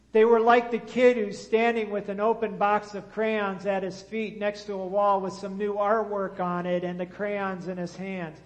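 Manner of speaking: ordinary talk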